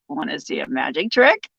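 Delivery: low, lightly put-on creepy voice; answering a chat request for the joke voice, mock creepy. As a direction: tease it like a shady stranger offering a trick. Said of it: "hooker and joke voice".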